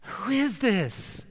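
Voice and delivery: very slow, puzzled guests' voice; murmuring dinner guests. Acted. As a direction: wonder aloud who this man is. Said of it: "They begin to say among themselves".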